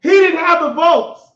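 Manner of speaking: loud repeated punchline; emphatic shout. Shown as a demonstration. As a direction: slam the point home. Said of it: "laughs, repeats louder".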